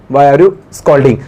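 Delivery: slow, deliberate dictation; teacher dictating slowly; slow model sentence. Shown as a demonstration. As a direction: dictate it for the class. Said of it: "आप क्यों, क्यों डांट रहे हो?".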